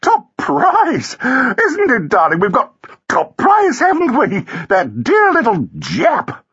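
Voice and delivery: very high, gushing wife; squealing, excited society woman; shrill delight. A narrator's reading. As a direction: crow shrilly to your husband. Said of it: "cried Flossie shrilly".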